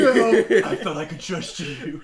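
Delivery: high, loud overwrought wail; melodramatic anguish. Pretend. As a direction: ham up the heartbreak. Mocking weeb betrayed by blade.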